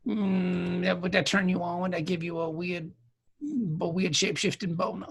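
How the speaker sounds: soft, hammed-up bedroom voice; mock seductive murmur. Plays a seducer murmuring to changeling.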